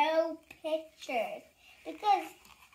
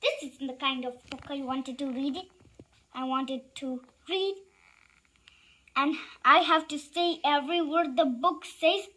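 ordinary delivery

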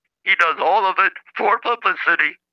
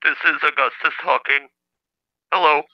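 stilted computer-synthesizer voice; Stephen Hawking. Acted. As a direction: talk like a speech machine, word by word. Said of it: "It kinda does sound like, uh, Stephen Hawking".

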